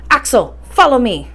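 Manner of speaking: a firm, loud order to the nephew; emphatic command. Acted. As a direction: bark it as a summons. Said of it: "these emphatic words at his nephew".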